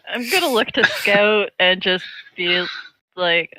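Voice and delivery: ordinary talk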